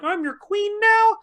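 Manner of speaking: very high, drawn-out incredulous question; exaggerated falsetto outrage; mock indignant squeal. Mocking the queen's indignant question.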